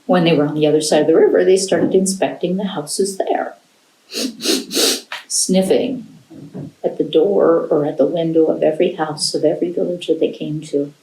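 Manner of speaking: ordinary delivery, nothing marked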